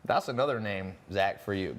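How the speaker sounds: normal talking voice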